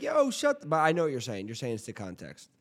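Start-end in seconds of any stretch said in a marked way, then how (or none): 0.0-0.7 high-pitched, cut-off retort; raised-pitch mock outburst; pretend arguing. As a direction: shoot it out high as if mid-argument. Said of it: "if we're arguing".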